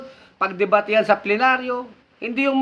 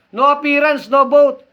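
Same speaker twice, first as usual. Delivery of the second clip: loud, punchy slogan, louder on the end; emphatic slogan; 3 semitones higher, 5 dB louder than usual. Real, not acted. Host to his viewers, punching the slogan loud. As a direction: hammer out the rule like a chant.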